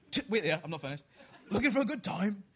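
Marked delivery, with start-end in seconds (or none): 1.5-2.4 louder, higher Cockney come-on; a cheeky Cockney proposition, pushed harder; hammed-up Cockney accent. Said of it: "do it in a Cockney accent".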